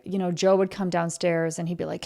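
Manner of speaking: ordinary talk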